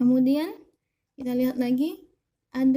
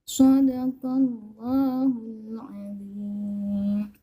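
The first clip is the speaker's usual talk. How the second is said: Quran recitation; 2 semitones higher than usual. Acted. Recites Ayat al-Kursi.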